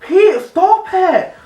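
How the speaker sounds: loud, very high, drawn-out mock protest; hammed-up high-pitched telling-off; Claire's scolding. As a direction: squeal it slowly as an exasperated colleague. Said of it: "She'd be like".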